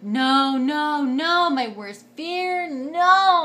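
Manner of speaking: high, loud wailing no's, "my worst fear" slowed; panicked crying. Retold or imitated. As the nurses send the anesthesiologist away, a high loud panicked wail. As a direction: wail it out as the last hope leaves. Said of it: "And I was, like, crying".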